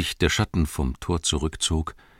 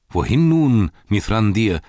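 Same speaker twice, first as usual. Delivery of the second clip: loud, higher-pitched hail; raised, ringing call; 4 semitones higher, 6 dB louder than usual. A narrator's reading. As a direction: hail someone urgently in passing. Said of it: "rief er".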